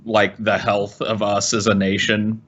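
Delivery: normal talking voice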